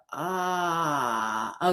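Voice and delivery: low, open-jawed "ah" of longing; yearning sigh. Shown as a demonstration. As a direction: drop the jaw and sigh "ah". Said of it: "drop your jaw".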